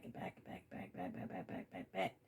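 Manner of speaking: rapid repeats dying away to a whisper; fading repetition. Shown as a demonstration. They keep coming back, fading to a whisper. Imitating someone endlessly returning.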